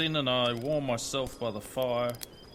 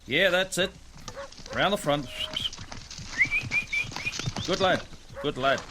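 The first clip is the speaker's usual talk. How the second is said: encouraging the dog, raised and higher; calling to the dog; 6 semitones higher than usual. Acted. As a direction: praise the dog from a distance. Drover calling to his dog.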